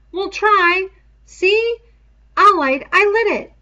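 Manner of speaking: put-on high, loud boyish voice, urgent; Piggy's high pleading voice. A narrator's reading. Piggy promises to keep fire.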